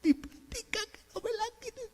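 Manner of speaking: high, thin timid whimper; a hammed-up coward clutching his possessions; fearful Gedeón. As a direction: fret softly in a high, scared voice.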